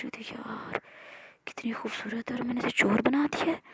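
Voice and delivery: whispered inner thought. A narrator's reading. Amara whispering her thoughts to herself about Maya. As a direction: hush it, thinking to herself. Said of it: "दिल ही दिल में सोच रही".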